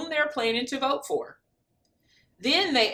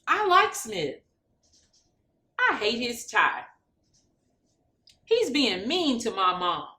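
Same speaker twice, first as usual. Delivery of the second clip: mild shout. Acted. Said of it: "shouted Angela".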